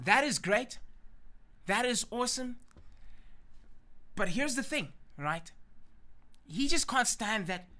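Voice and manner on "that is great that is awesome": high, pleased gloating; delighted, emphatic self-congratulation; happy, self-satisfied. Acted. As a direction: relish it, pitched up high. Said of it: "he's happy".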